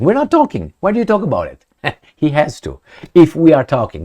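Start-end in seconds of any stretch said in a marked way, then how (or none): 0.0-1.6 high mock protest. 3.1-4.0 loud mocking quote. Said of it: "And I'm quoting".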